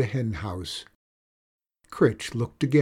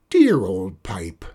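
slow, low and tender, savouring; fond; 4 semitones lower, 5 dB louder, slower than usual. Acted. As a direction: croon to the old pipe lovingly. Witch cherishes her pipe.

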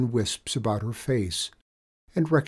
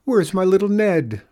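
much higher, louder anxious cry; frightened cry; 10 semitones higher, 7 dB louder than usual. Acted. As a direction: call out for a missing child. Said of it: "and cried".

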